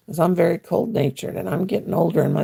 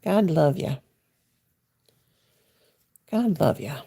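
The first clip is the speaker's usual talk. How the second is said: normal talking voice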